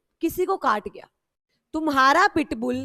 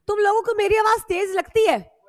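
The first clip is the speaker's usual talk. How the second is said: loud, higher-pitched challenge; 5 semitones higher, 4 dB louder than usual. Real, not acted. Teacher asking students about her voice, loud protest. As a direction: ask it loudly, protesting. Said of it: "थोड़ा तेज लगता है".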